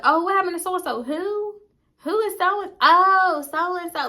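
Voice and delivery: high, baffled mock voice; confused high squeal. Storyteller mocking her own confusion, high and baffled. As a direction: squeak out the names like you can't place them. Mocking herself forgetting friends.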